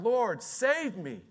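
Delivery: high, drawn-out cry for rescue. Acted. Sinking Peter cries to Jesus for help, crying out. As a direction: call out urgently, stretching the words. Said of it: "he cried out".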